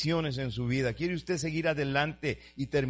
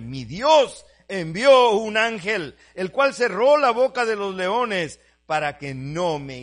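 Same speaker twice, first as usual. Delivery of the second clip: loud, higher, slow proclamation; full-force preaching; 6 semitones higher, 11 dB louder, slower than usual. Acted. Preacher proclaims Daniel's answer to the king, loud and slow. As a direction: announce the angel like a triumph.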